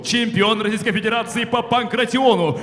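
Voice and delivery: booming ring announcer intro; boxing ring announcer. Acted. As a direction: announce the fighter to the arena.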